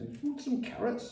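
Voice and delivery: high mock offer of carrots; quoted neighbour. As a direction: lightly play the French neighbour offering.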